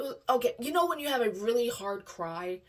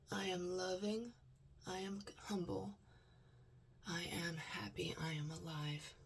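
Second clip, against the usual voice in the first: quiet affirmations; 8 dB quieter than usual. Real, not acted. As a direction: murmur each affirmation to yourself. Self-affirmations.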